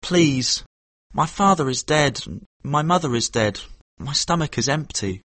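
mild, pleading sadness; saddest voice. A narrator's reading. Boy begging the horse dealer for food, mildly sad. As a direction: plead pitifully, with understated sorrow. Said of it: "cried Kim in his saddest voice".

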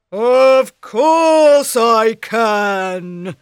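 loud, very slow ogre brag; boasting ogre. Acted.

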